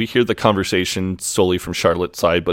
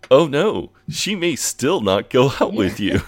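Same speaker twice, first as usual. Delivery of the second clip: higher, singsong mock sympathy; mock concern; 6 semitones higher than usual. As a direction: pitch it up and feign dismay to tease. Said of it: "It's the way he says it".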